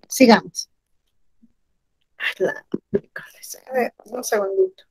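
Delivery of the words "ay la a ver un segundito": whispered aside off the mic; a quick hushed word to someone nearby. Real, not acted. Aparte durante clase en línea. (susurro rápido, aparte)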